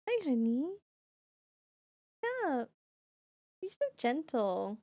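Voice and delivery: high cooing to a gentle cat. Real, not acted. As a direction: pet voice, sing-song and high.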